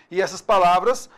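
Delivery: normal talking voice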